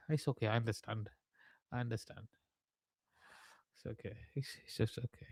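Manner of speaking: soft pretend sympathy, barely above a murmur; mock consoling. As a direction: pat them on the head with your voice, teasing. Mock consoling a viewer with two dads.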